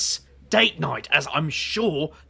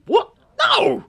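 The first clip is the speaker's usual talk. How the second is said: sudden loud high No, shocked denial; emphatic shout; 11 dB louder than usual. Acted. Denying a killing to a friend, shouted. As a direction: fling the word out at full volume, much higher.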